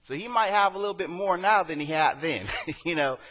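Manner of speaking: preacher jokes to the church, lifted and laughing; playful aside. Real, not acted. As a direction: tease the congregation. Joking aside to congregation.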